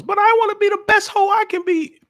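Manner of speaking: high, squeaky mock woman boasting; mock girly voice. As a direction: play a proud woman, voice way up high.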